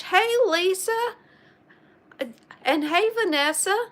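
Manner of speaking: high, loud happy hello; excited greeting. Real, not acted. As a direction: call out cheerfully to friends. Greeting viewers by name.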